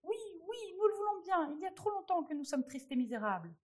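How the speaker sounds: the people joyfully cry out their approval; crowd cheering. A narrator's reading. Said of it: "chacun cria".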